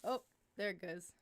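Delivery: ordinary talk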